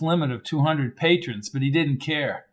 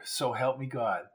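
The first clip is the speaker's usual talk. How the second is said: a hushed, solemn oath; whisper-soft, near the casket; the aunt's soft vow; 3 semitones lower, 12 dB quieter than usual. A narrator's reading. Aunt repeats the oath softly.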